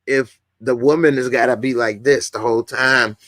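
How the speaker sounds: usual speaking voice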